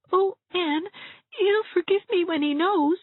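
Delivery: very high, whimpering plea; tearful pleading. A narrator's reading. Tearful Dovey voiced in story.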